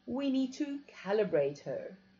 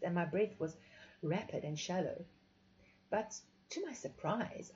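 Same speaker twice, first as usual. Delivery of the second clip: ordinary delivery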